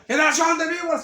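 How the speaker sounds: loud, high, accusing shout; 고함치듯 외쳐, 높고 크게; shouted accusation. Shown as a demonstration. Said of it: "que les grite".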